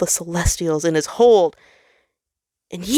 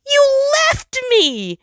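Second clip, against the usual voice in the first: very high, loud and slow, a wailing cry; heartbroken cry; 5 dB louder, much slower than usual. Acted. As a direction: hurl it out as a broken-hearted wail. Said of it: "with a heartbroken cry".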